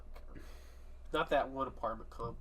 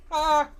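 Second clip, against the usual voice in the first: excited shout; 13 dB louder than usual. Real, not acted. Cry after pun.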